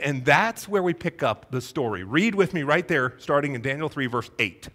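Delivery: normal talking voice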